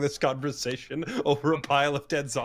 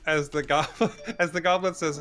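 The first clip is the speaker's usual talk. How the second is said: ordinary delivery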